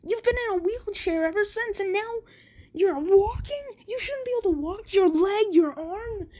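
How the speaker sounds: put-on bully can't believe the injured boy walks; high, rushing shocked rant; shocked disbelief. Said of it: "he's like".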